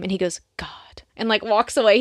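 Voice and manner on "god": low-volume guttural groan of disgust; hushed throat-growl impression; guttural imitation. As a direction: mimic a throaty, exasperated mutter. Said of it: "guttural, like".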